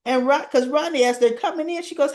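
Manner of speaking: usual speaking voice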